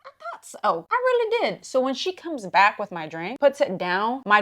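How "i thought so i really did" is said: very high, put-on little voice; mock-innocent agreement. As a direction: play the wronged innocent in a high voice. Retold answer agreeing she was rude.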